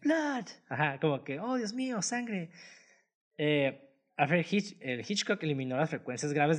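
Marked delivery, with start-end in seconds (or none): 0.0-0.4 very high mock cry; high-pitched imitated yell; Norman Bates's horrified cry. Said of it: "el vato empieza a gritar como". 1.4-2.5 high, mock-horrified translation; high-pitched hammed-up dismay; Norman Bates's horrified cry. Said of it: "el vato empieza a gritar como".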